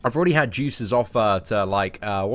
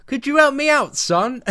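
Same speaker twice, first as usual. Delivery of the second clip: much higher, louder parody voice; Analyzer impression; 12 semitones higher, 7 dB louder than usual. Streamer mimicking a video's parody of himself, high-pitched impression. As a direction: imitate a high-pitched British-accented caricature. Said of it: "That's the voice. That is the voice".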